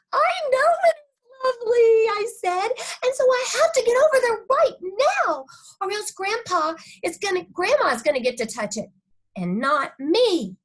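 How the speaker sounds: put-on high, loud, urgent kid voice; frantic little girl. Junie B begging to leave for Grandpa's now. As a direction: rush it out in a panic.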